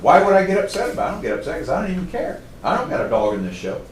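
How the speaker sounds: high, fast, loud insistence of not caring; worked-up show of not caring; protesting indifference. Acted.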